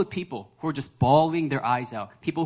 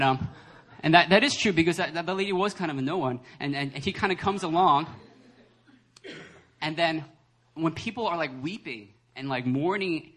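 ordinary talk

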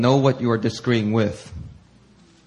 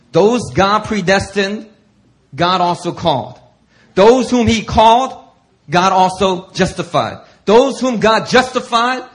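loud, higher, slow in places; preaching scripture; 6 semitones higher, 6 dB louder, slower than usual. Acted. Preacher rereading the verse to make it plain, loud. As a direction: hammer each clause home.

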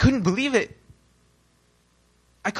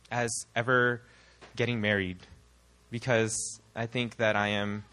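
mopey confession; 5 semitones lower, 8 dB quieter than usual. Retold or imitated. Confiding in small group leader Tina, soft and mopey. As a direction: pour your heart out in a drooping voice.

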